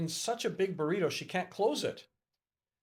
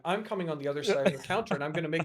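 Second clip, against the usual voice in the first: fast playful mock threat; faster than usual.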